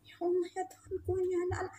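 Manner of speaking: high, hushed exclamation; hushed dismay. Retold or imitated. Teacher aghast at the students behind her, hushed dismay.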